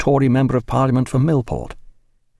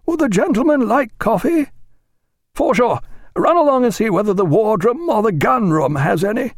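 high-pitched, hearty captain's call; captain crying out; 12 semitones higher than usual. A narrator's reading. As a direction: call out the question and order to the steward. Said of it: "cried Captain Fellows".

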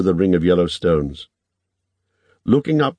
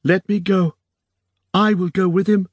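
high, urgent plea; terrified pleading; 12 semitones higher than usual. A narrator's reading. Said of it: "her face gone wild and terrified".

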